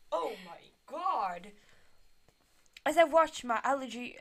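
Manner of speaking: ordinary talk, nothing marked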